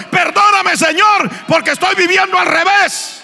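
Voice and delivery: loud, high cry for forgiveness; shouted penitent prayer. Acted. Preacher voices a sinner's plea at the altar, shouted.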